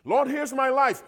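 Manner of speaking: a hint of a showy prayer, lifted up high; mock grand surrender. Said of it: "Here's how some people surrender themselves".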